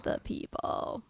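slow, airy whispered echo; whispering. Pretend. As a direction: breathe the words back.

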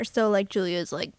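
ordinary talk

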